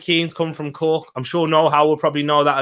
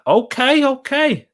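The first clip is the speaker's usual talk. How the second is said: surprised okay; 11 semitones higher, slower than usual. Real, not acted. Learning Liam Miller came from Cork.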